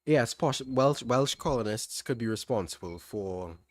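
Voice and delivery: usual speaking voice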